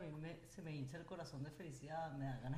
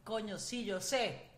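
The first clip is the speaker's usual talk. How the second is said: excited gushing; 6 semitones higher, 15 dB louder than usual. Real, not acted. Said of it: "excited host gushing".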